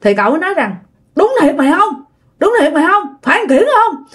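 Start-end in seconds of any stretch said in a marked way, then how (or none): 1.1-4.0 high-pitched nervous checking; acted fearful uncle, voice pitched up; scared, doubting questions. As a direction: ask warily whether it's really him. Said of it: "Còn giờ ông sợ".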